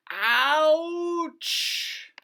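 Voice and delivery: high squeaky yelp of pain; squeaky little ouch; cartoon ouch. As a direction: yelp it like a pinched toy truck. Dinosaur pinched by crabs.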